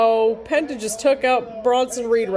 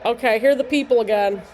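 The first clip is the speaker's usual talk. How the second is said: usual speaking voice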